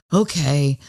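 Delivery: heavy sigh, then a low, grudging okay; exasperated huff. Acted. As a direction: sigh it out like a sulking partner asked to help. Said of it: "How about huffing?".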